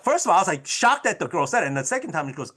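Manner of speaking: ordinary talk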